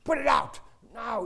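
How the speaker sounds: put-on half-yell an order like a small boy; little son telling a smoker off; a touch of shrieking. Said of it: "he had a shrieking voice".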